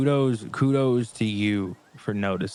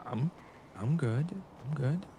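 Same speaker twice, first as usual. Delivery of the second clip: sad condescending voice; 5 semitones higher, 8 dB quieter, slower than usual. Acted. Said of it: "what's with the sad, condescending voice?".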